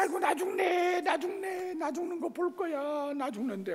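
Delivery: very high mock lament; hammed-up wailing. Wife lamenting as father and son fight, hammed-up wail. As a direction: wail theatrically as if dying.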